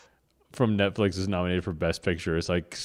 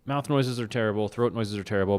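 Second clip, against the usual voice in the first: ordinary talk, nothing marked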